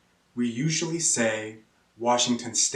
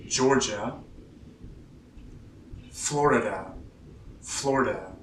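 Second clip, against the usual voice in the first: usual speaking voice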